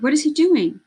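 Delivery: high, loud panicked question; mother watching her father jump overboard; alarmed. A narrator's reading. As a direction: ask it in sudden fear. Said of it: "Isabel's mother cried".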